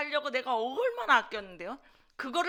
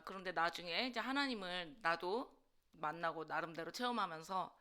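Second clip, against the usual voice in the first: ordinary talk, nothing marked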